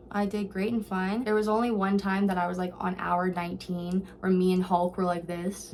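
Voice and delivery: ordinary talk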